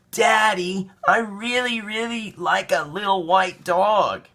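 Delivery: put-on high, slow pleading child; little boy voice. A narrator's reading. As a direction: beg sweetly like a small boy. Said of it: "Caillou said".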